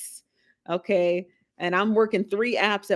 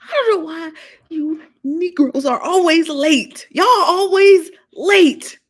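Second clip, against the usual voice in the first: higher, louder, slow drawn-out scolding; loud mock scold; 9 semitones higher, 9 dB louder, slower than usual. Host teasing late viewers, loud mock scolding. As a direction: belt it like an exasperated auntie.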